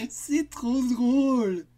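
high, loud, slow words through laughter; can barely get it out for laughing. Acted. Children cracking up at the monster's pun, laughing loudly. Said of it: "rire à gorge déployée".

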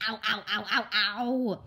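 whimpering ow. Acted. Girl with popping ears in the car, crying ow. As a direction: cry out with each ow. Child in ear pain.

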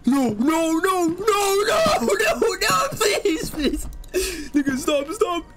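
panicked high screaming pleas; scared horror game streamer. Real, not acted. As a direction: scream no in real terror.

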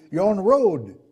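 ordinary delivery

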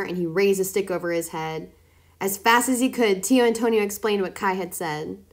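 plain reading voice

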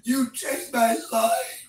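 high, drawn-out anguished yell; real desperate shout. Real, not acted. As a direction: wail it out slowly and high. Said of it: "Why are you yelling?".

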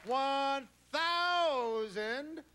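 slow, high, stretched announcement; drawn-out price reveal. Acted. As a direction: stretch every number out.

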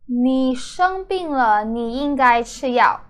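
ordinary delivery

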